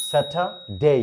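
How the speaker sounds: "স্যাটারডে" split as "satha... day", no R; correct English pronunciation. Shown as a demonstration. Teacher repeating for learners, "স্যাটারডে" carefully pronounced as "satha-day". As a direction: give "স্যাটারডে" a long gliding "day" ending. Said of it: "ডে আজকে থেকে বলবো না".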